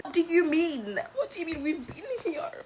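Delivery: high-pitched imitation of stunned people; mock disbelief. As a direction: act out people going crazy over the news. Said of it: "like, people are going crazy".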